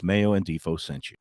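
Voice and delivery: ordinary delivery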